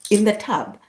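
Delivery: ordinary talk, nothing marked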